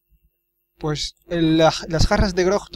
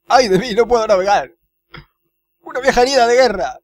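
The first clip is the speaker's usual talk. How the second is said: louder, hammed-up woe-is-me excuse; whining cook; 6 semitones higher, 6 dB louder, faster than usual. Cook excusing himself from sailing, in a theatrical whine. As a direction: wail it like a mock martyr. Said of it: "quedarse aquí llorando".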